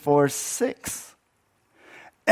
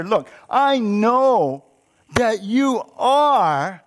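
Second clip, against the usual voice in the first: high, slow, drawn-out accusation; angry accusation; 4 semitones higher, 3 dB louder, much slower than usual. Acted. Jonah throwing God's character back at him, angry. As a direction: stretch each stressed word in exasperation. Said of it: "Jonah in anger, he attacks God".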